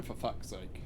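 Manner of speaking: ordinary talk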